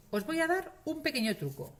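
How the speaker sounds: ordinary delivery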